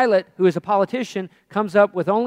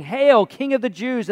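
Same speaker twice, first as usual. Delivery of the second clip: higher, louder, slow-drawn salute; loud hailing; 6 semitones higher, 6 dB louder than usual. Acted. Soldiers salute the thorn-crowned prisoner, loud hailing. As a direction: proclaim it slowly as a raised hail. Soldiers hail Jesus in mockery.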